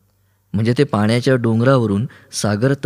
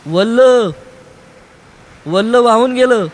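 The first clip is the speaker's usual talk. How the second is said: much higher, louder cry, angry with himself; despairing angry shout; 12 semitones higher, 5 dB louder than usual. A narrator's reading. Boy shouts to the girl that the oar is gone. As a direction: wail it out, furious at yourself. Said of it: "तो हताशपणे ओरडला, स्वतःवरती चिडून".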